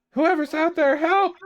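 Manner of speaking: muffled high yell from indoors; distant cry for help from inside; yell from inside the house. Acted. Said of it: "you kind of hear a yell".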